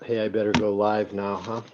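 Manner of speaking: usual speaking voice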